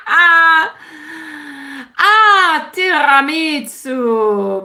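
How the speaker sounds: high, loud, gushing ah then a slow tiramisu; delighted gasp. Real, not acted. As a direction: swoon over the dessert. Gushing over her favourite dessert.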